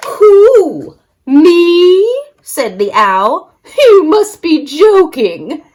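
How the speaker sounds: high, hooting owl voice. A narrator's reading. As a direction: hoot it like an owl.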